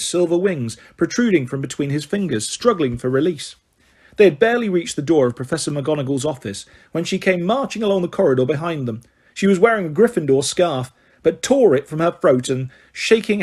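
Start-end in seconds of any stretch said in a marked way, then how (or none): none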